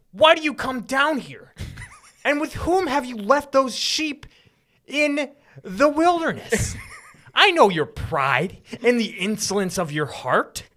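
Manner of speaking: high, loud, indignant elder brother; angry older brother. Shown as a demonstration. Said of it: "let me show you what he, Eliab sounds like".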